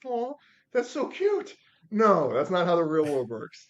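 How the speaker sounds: high, loud, drawn-out teasing coo into a firm no; mock condescension. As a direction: imitate a boss gushing in mockery, then shooting it down loudly. Boss mocks his naive answer.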